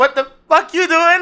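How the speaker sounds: high, broken-up comic outburst; mock Michael J. Fox. Listener redoing Michael J. Fox's reaction, mock outburst. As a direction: exaggerate the indignant cry for laughs. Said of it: "Or more like".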